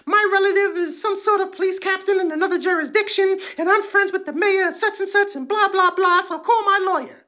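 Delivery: high, mocking arrestee voice; shrill arrestee. Comic mimics an arrestee demanding a lawyer, high mocking voice. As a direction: squeal for a lawyer like a spoiled detainee. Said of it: "they always have a story".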